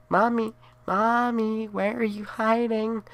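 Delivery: put-on the undead boy hunting his mother; creepy sing-song child. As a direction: tease her out of hiding. Ghost child searching for mother.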